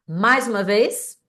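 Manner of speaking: ordinary delivery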